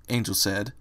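plain reading voice

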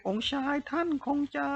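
high-pitched urgent call. A narrator's reading. Feng Xin calls to the prince as he takes the bait, crying out high. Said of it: "เฟิงซินร้องเรียก".

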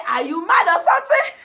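loud, high mock of the catchphrase; loud mocking taunt. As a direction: yell it like the street star. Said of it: "Portable say".

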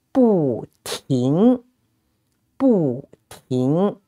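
"不" falls, then "停" rises; fourth-tone bu. Shown as a demonstration. Teacher giving a phrase to repeat, "不" falling, "停" rising. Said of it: "bu, with the fourth tone".

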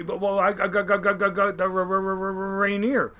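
stuttering on got and R- before Rainier; mock stammer as the old Norwegian skipper; imitating a stutter. Retelling the skipper's reply with a stutter. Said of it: "he had a real bad stutter".

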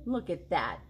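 ordinary delivery, nothing marked

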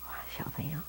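softly whispered wonder. Real, not acted. Hushed aside to children.

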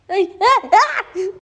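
high yelps with laughter; loud high cries, half laughing; pained shrieks. Real, not acted. Woman bitten by her dog, laughing shrieks.

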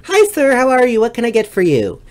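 chirpy customer-service hello; friendly server greeting. Retold or imitated. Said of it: "what you're supposed to do is say".

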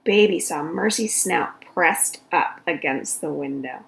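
ordinary delivery, nothing marked

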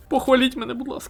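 squeaky high pleading voice; high whiny plea for praise; begging for praise. Pretend. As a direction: beg the chat in a tiny high voice. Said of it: "Особисто я точно".

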